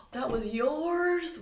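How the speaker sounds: high, loud, drawn-out dismay; groaning neighbour. Acted. Neighbour groaning on learning the book was hers. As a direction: groan out the realisation. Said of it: "Wally groaned".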